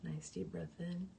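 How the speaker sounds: soft, slow meditation voice with long pauses; guided meditation. Real, not acted. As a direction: guide the breathing gently and quietly, drawing out each exhale. Leads cleansing breaths.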